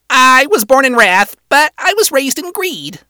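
high imp employee voice; high-pitched imp. A narrator's reading. Male employee saying where he's from, high-pitched. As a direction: answer as the high-voiced Moxie. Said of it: "voice scratchy and high-pitched".

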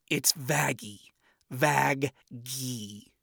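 soft, slow, near-whispered deadpan. Acted. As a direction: say it flat and unimpressed. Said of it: "replied in a deadpan manner".

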